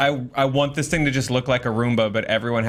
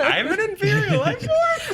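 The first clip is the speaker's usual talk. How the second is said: laughing; slower than usual. Pretend. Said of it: "You're like".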